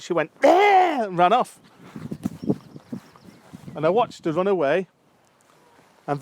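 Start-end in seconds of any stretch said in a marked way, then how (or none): 0.4-1.0 high, loud startled shriek; panicked scream. Retold or imitated. Italian lady fleeing a bumblebee, panicked scream. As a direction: imitate a woman screaming in panic. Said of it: "she just panicked".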